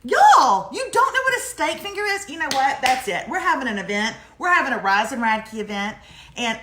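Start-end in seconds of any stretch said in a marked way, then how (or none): 0.0-2.3 much higher, louder incredulous question; real disbelief that nobody knows. Real, not acted. Disbelief at unfamiliar food.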